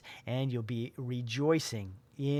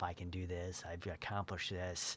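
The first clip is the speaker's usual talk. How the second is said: softly, almost to yourself, a hushed self-pep talk; quiet self-talk; 6 semitones lower, 10 dB quieter than usual. Shown as a demonstration. Athlete quietly telling himself he can win, hushed. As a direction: murmur it inward like private encouragement. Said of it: "Keep telling yourself".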